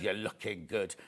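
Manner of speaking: "You're looking good" dropped low and soft; put-on intonation. Host reading out Susan's compliment, with put-on intonation. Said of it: "I put that intonation on it".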